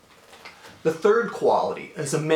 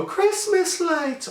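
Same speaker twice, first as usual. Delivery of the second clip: friends' awestruck cry; much slower than usual. Retold or imitated. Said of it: "they go".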